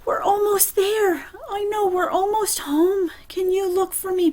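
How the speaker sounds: put-on high, pleading, frightened boy; panicked boy. Boy begs the preacher to look, high and panicky. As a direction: beg him to look, too scared to. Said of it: "the boy says".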